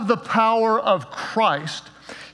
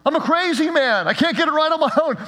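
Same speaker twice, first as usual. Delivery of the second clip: relieved joy; 8 semitones higher than usual. Retold or imitated. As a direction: burst out thanking God. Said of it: "his tone changes".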